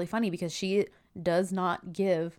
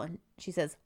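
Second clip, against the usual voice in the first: ordinary talk, nothing marked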